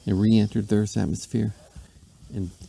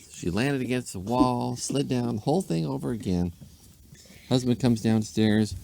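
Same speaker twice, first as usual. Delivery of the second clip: ordinary delivery, nothing marked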